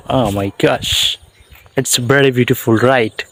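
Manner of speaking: normal talking voice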